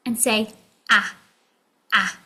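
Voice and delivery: normal talking voice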